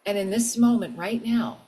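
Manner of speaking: soft, unhurried meditation voice; guided meditation. Acted. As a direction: guide them gently inward.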